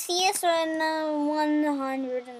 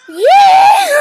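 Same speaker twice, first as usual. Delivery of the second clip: loud gleeful yell into a laugh; big excited whoop then laughing; excited shout; 13 semitones higher, 15 dB louder than usual. Real, not acted. Excited walrus takeoff moment.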